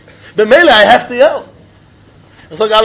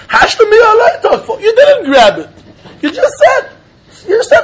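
high-pitched protest; 11 semitones higher than usual. Acted. Student argues he didn't grab it, high-pitched protest.

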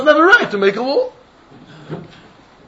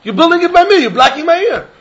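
higher, insistent protest; raised voice in mock quarrel; 8 semitones higher than usual. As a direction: push back as the offended neighbor. Acted neighbor quarrel.

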